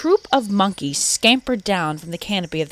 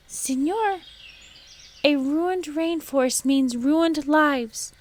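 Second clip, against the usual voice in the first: squeaky tree frog; 8 semitones higher than usual. A narrator's reading. Tiny frog piping in the man's ear, high and squeaky. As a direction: pipe up small and high. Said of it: "In a squeaky voice".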